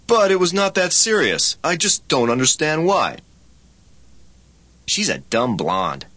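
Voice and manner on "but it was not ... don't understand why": higher-pitched, baffled protest; acted protest. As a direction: plead your case in a higher voice. Dialogue: protesting against being blamed.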